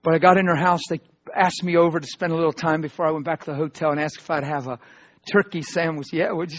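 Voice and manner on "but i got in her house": ordinary talk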